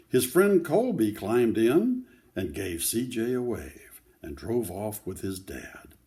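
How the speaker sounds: put-on steady read-aloud voice for children; story narration. A narrator's reading. Grandparent reading a picture book to children, gentle read-aloud. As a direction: read the story to the kids, clearly.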